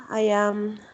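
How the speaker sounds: usual speaking voice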